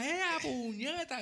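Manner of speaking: high, slow startled shout of the curse; a high yelled curse on spotting grandma's car; shocked yell. Retold or imitated. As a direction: blurt it out in sudden shock. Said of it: "Grité".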